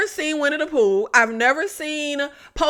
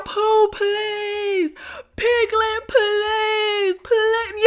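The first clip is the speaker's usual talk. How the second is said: high, pleading mock sob; imitating crying pleas; 8 semitones higher, much slower than usual. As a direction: beg like a sobbing victim, pitched high. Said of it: "he was crying and yelling".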